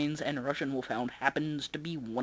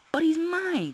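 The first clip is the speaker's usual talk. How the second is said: put-on high pleading kid; child voice; 7 dB louder, slower than usual. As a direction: whine it like a small boy. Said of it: "Toby said".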